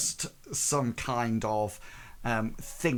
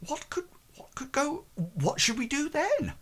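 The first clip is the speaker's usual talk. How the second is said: high, breathless whisper rising to voice; worried character voice, pitched high; high whispered fret; 14 semitones higher than usual. Acted. Character panics about the audience.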